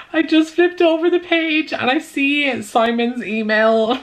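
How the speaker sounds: high, drawn-out tearful wail; real crying. Real, not acted. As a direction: fall apart crying from sheer emotion. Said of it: "let that cry out".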